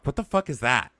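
usual speaking voice

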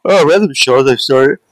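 talking through a yawn; words stretched by a big yawn. Real, not acted. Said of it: "big yawn".

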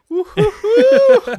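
very high, loud drawn-out woo-hoo; Troy's celebratory whoop. Retold or imitated. Host mimicking his friend's reaction to a fumble, celebratory whoop. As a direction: whoop it up in imitation, high and long. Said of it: "Classic Troy sounds".

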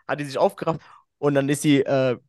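normal talking voice

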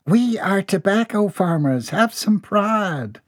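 a mother's prim rebuke; scolding mother. A narrator's reading. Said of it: "mother scolds son".

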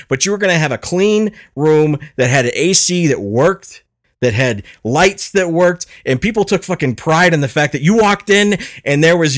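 high, loud, exasperated comic tirade; comically irate rant. Acted. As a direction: rant like an outraged stand-up comic. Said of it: "I was getting so irate".

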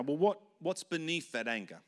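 ordinary talk

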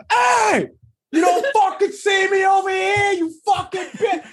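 high shouted curse at a truck; sudden yell. Retold or imitated. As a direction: scream at the driver, laughing through it. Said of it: "in mid-sentence".

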